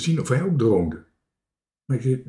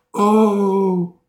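high, loud drawn-out oh; awed cry; 8 semitones higher, 7 dB louder than usual. A narrator's reading. Marinka admires the island as they arrive, awed cry. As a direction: cry out at the lovely sight. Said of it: "riep Marinka".

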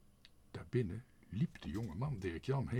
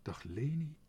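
higher, louder, mildly surprised hello; taken aback, raised-pitch greeting; 7 semitones higher, 5 dB louder than usual. A narrator's reading. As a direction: greet her with some surprise. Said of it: "hij keek verbaasd".

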